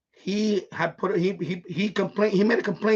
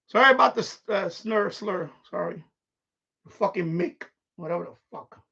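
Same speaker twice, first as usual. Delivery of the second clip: normal talking voice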